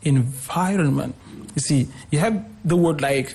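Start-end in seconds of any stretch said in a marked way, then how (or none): 0.0-1.1 "environment" with VI stressed; second-syllable stress. Shown as a demonstration. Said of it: "on the second syllable".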